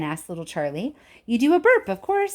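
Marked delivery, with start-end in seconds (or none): 1.3-2.3 gleeful. A narrator's reading. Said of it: "said Mister Wonka".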